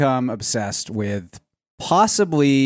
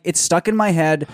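ordinary talk, nothing marked

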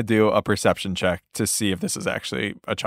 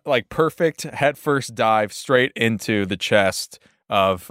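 ordinary talk

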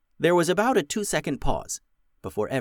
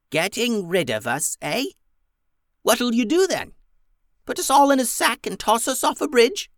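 high, loud, scornful goblin voice; a shrill-sounding old goblin mocking them; 12 semitones higher, 4 dB louder than usual. Goblin leader mocks the girls' plan, high and scornful. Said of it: "said the goblin".

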